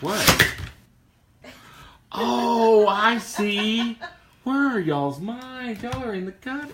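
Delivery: high, slow, scandalized protest; mock indignant. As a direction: act shocked and offended. Mock offended at a dirty joke.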